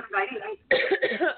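short dry cough from a throat tickle. Real, not acted. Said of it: "I have a tickle in my throat".

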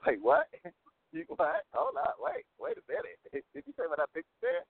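retold incredulous reaction, broken up by laughs; laughing disbelief. Retold disbelief at clerk's refusal.

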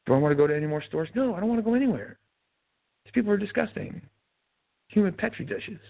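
high, quick, fussy mock-panic voice; mock germophobe. Man mocks shoppers afraid of crowds in a high fussy voice. Mocking fearful stay-at-home shoppers.

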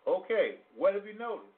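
ordinary delivery

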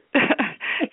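bright high-pitched giggle; quick happy squeal of laughter. Real, not acted. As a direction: laugh with delight at the farewell. Laughing during goodbyes.

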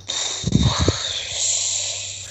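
long drawn-out weary exhale; long sigh. Real, not acted. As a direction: let out a long, tired sigh. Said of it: "It's a long sigh".

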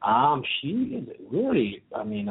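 usual speaking voice

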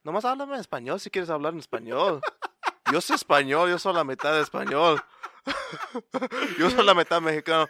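fast Spanish, laughing through it; laughing mock scolding; laughing retort. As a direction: rattle it off in Spanish while cracking up. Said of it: "then I just say".

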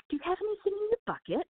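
high, quick British child voice, imitated; a light mock English kid asking eagerly; child's British accent. British kids asking the snake wrangler, high and quick in imitated British accent. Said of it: "beautiful little British accents".

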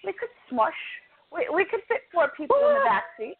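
a light mock of an eager friend; imitating her friend. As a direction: play the friend pleading for room. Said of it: "And she goes".

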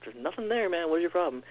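high, pleading exasperation; exasperated scolding. Retold or imitated. Owner to a dog freaking out in his crate, exasperated.

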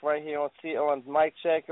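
ordinary talk, nothing marked

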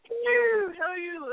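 high, sing-out mock British hello; horrible British accent. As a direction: ham up a bad British accent. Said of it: "my horrible accent".

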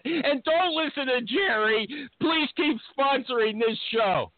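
high, urgent mock begging; hammed-up plea. Host begging sponsor not to listen to Jerry, hammed-up plea. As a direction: plead theatrically to keep the sponsor.

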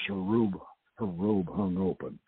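plain reading voice

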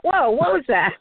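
a high, startled "Whoa" as if hit by surprise; mock stunned. Listener imitating her own stunned reaction to the teaching. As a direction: act like the teaching just knocked you back. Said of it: "And it's like".

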